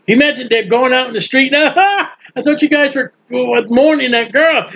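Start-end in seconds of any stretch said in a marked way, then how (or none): 1.8-4.7 high mocking gossip, laughing; gossiping townsman. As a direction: jeer like a gossip on the street.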